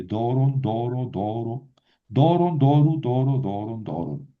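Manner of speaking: doron, doru, doro all stressed on DO; rhythmic drill hitting the DO of doron; stress on first syllable. Shown as a demonstration. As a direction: keep the accent fixed on the first syllable of doron. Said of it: "el acento no se mueve".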